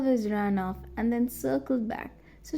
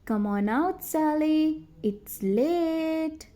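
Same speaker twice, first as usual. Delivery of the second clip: calling out, a bit higher at the end; yelling again; 6 semitones higher, slower than usual. A narrator's reading. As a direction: call across the dark. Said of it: "They yelled again".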